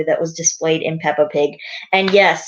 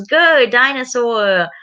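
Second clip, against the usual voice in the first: put-on high, slow, drawn-out toddler character; George; 5 semitones higher, 4 dB louder, slower than usual. George playing with his toy dinosaur, high and drawn out. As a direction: stretch the words out playfully, pitched up. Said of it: "George says".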